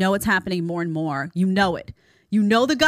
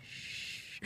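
soft unvoiced shush; 23 dB quieter than usual. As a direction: hush her with a sharp shh. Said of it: "I'm just like".

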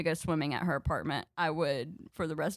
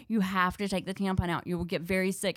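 ordinary delivery

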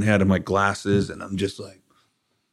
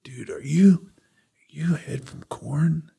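lightly voiced fan, breathy and slow; 7 semitones higher, slower than usual. Fan at a bar recognizing him, whispered awe. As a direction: mimic an awed fan asking. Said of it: "someone come up to me and be like".

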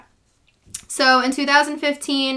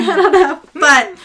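laughing, louder and higher; 5 semitones higher, 9 dB louder than usual. Real, not acted. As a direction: laugh through it. Laughs, repeats answer.

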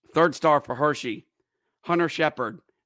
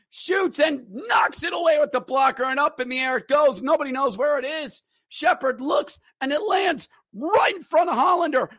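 much higher, shouting goal call; frantic play-by-play; 10 semitones higher than usual. Real, not acted. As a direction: scream the overtime goal in disbelief.

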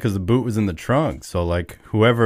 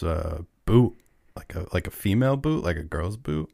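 normal talking voice

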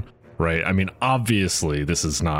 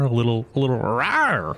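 little dragon rawr; 5 semitones higher than usual. Acted. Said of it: "a little dragon roar".

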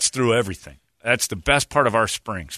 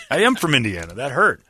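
usual speaking voice

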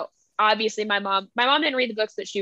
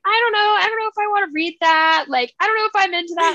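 reluctant sister; 7 semitones higher, 3 dB louder than usual. Retold or imitated. Sister hesitating over recommended book.

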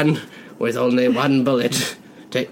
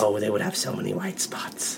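whispering; 6 semitones lower, 9 dB quieter than usual. Acted. Seductive whispered reply.

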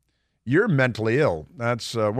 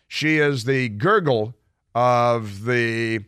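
slow sneering; much slower than usual. Acted. Host mocking congresswoman's name.

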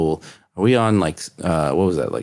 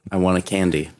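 usual speaking voice

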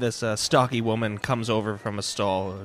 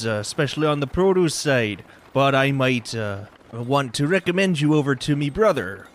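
a louder, hearty market trader; loud folksy vendor; 2 semitones higher, 3 dB louder than usual. Acted. Market vendor Dougal talks up his brother's stall, hearty and loud. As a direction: boom it out like a friendly stallkeeper.

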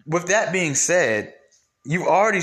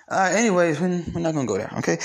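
ordinary talk

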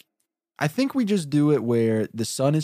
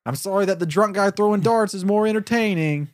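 lightly higher annoyed complaint; irritated singer; 7 semitones higher than usual. Acted. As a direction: complain like a miffed performer.

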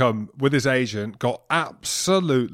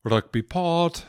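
drawn-out, high Welsh lilt; Welsh accent; 5 semitones higher, much slower than usual. Pretend. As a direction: put on a singsong Welsh accent, slowly. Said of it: "That was my Welsh accent".

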